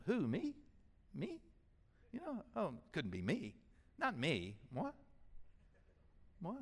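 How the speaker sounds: mock-innocent who, me?; joking who me. Shown as a demonstration. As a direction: play coy surprise, pointing at yourself. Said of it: "in a kind of a joking way to exclaim".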